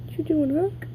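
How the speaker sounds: high, sing-song coo at a pet; playful pet talk. Real, not acted. Cooing at pet bird.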